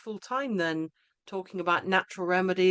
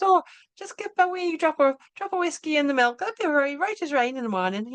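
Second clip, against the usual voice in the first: higher, lilting imitation of an Irish mum; Irish accent; 8 semitones higher, 4 dB louder than usual. Irish school mum giving a remedy tip, in a higher imitated Irish accent. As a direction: put on a higher Irish accent for the whiskey advice. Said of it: "this Irish woman, she says".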